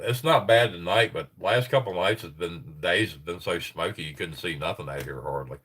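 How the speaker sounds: ordinary talk, nothing marked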